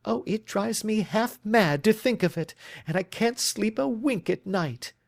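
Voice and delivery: put-on distraught young woman. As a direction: let the worry spill over.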